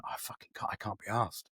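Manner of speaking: a hushed, breathy whisper of giving up; barely voiced, sinking into the words; whispered despair. Whispering to himself in a dark headspace. Alone, retelling his lowest moment.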